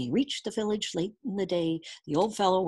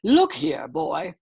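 loud, gruff royal growl; growling king; 5 semitones higher, 6 dB louder, slower than usual. A narrator's reading. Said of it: "growled the king".

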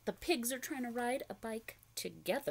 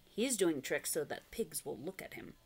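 plain reading voice

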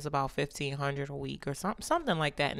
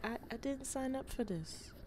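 put-on baby voice; 5 semitones higher, 12 dB quieter than usual. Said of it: "Baby's like".

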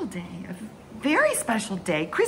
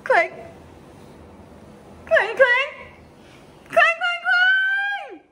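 clanging bell calls; slower than usual. Acted. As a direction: call out clang higher each time like a bell. Child ringing bell, escalating.

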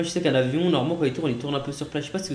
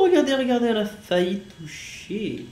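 excited; 8 semitones higher, 4 dB louder than usual. Real, not acted. Near miss in flight sim.

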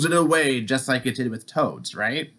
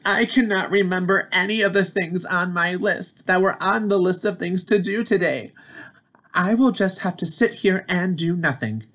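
higher, glum Toad voice; pitched-up sulky toad; 6 semitones higher than usual. Acted. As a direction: mope as Toad who lost his list. Toad's voice in read-aloud.

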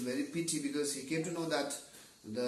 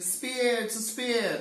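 higher, louder repeated answer; arguing loudly; 8 semitones higher, 5 dB louder, slower than usual. Acted. Another blind man joining the shouting, arguing loudly. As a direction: throw your answer into the row. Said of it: "shout grew louder and louder".

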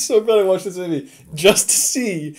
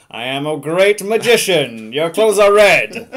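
slow, declaiming villain line; hammy film villain, slow and loud; bad guy; 2 semitones higher, 6 dB louder, slower than usual. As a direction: boom out a bad guy's line slowly. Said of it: "where the bad guy goes".

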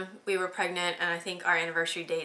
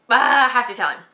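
louder, higher panicked ah; realizing it in a rush of panic; 4 semitones higher, 8 dB louder than usual. Retold or imitated. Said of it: "and I'm like".

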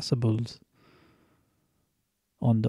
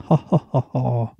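loud villain laugh ha ha; evil laugh; 5 dB louder than usual. Shown as a demonstration. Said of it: "Like an evil person".

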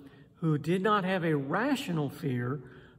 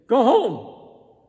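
loud, firm order to a dog; authoritative command; 5 semitones higher, 10 dB louder than usual. Retold or imitated. Preacher showing how he orders a growling dog away. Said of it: "try to sound authoritative".

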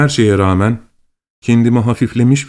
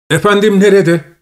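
anxious shout; 9 semitones higher than usual. A narrator's reading. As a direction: call out searching for the master. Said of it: "diye bağırdı". (efendisini arayan uşağın yüksek sesli bağırışı)

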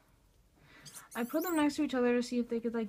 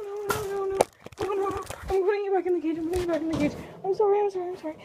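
high, urgent, frantic repetition; panicked; 6 semitones higher, 5 dB louder, much faster than usual. Real, not acted. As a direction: apologise frantically in a raised voice. Panicking while separating fighting pets.

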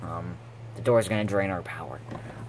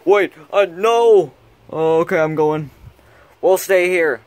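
loud, high and frantic; panicked shout; 7 semitones higher, 10 dB louder, slower than usual. Acted. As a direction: cry out in panic. Panic as door closes.

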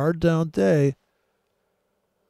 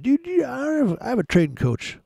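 mock fan; 11 semitones higher than usual. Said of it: "No Nonsense trained me".

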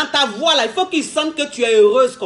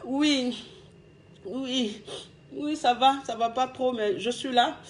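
much softer, barely there and downcast; faint, dejected phone reply; sad and subdued; 9 dB quieter, slower than usual. Shown as a demonstration. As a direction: let him hear you're hurting. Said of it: "quand il va t'appeler là".

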